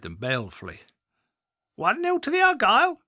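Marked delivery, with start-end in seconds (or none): 1.8-3.0 high, loud parrot squawk. A narrator's reading. The parrot squawks at the visitors, high and shrill. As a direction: screech it like a cheeky parrot. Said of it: "it squawked and cackled".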